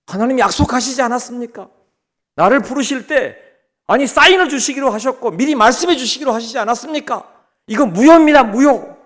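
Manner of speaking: high-pitched, mounting protest to God; angry old man. Acted. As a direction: object indignantly, building to a loud finish. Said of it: "막 화를 내는 겁니다". (노인이 화내며 따지듯이)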